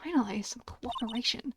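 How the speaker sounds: hushed, relieved whisper; soft private remark under the breath; whispered aside. Acted. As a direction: whisper it to yourself. Muttered aside about the witness.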